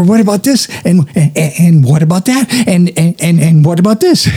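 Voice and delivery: high, stammering rapid-fire questions; mock over-caffeinated interviewing; hyper interviewer. Shown as a demonstration. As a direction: trip over yourself in high-pitched eagerness. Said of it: "I just get so hyper".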